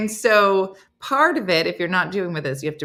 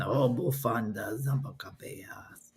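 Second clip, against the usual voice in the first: low, whispery, slow creepy man; soft deep Spanish lesson voice; deep-voiced Duolingo man; 8 semitones lower, 10 dB quieter, slower than usual. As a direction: mimic the deep language-app man, very hushed. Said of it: "the guy with the deep voice".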